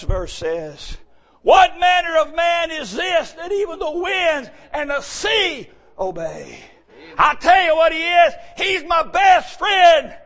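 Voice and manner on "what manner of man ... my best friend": loud, high preaching, slowing on the refrain; preaching at full force. Acted. Preacher shouting who his best friend is to the church. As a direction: cry the refrain out slowly.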